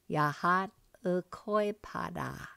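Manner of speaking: "Yahat ukoipada" drawn out slowly, syllable by syllable; slow it right down so learners can follow; careful slow model for the class; slow teaching repeat. Shown as a demonstration. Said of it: "So let's repeat those".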